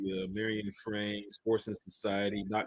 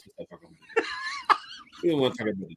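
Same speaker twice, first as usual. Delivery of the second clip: laughing plea; 5 dB louder, faster than usual. Real, not acted. Joking plea to drop the topic.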